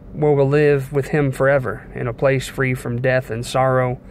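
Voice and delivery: normal talking voice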